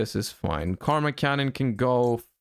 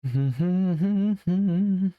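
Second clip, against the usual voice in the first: laughing; 6 semitones higher than usual. Real, not acted. Laughing alone on line.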